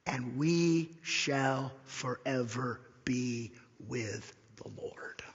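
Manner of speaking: slow, spaced words sinking to a hush; deliberate quotation. Retold or imitated. Preacher correcting a misquote with Paul's words, slow and deliberate.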